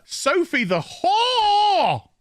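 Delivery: slow, high announcer drawl of a title; mock grand title. Herald announcing Sophie's title, slow and high. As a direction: proclaim her title like a herald.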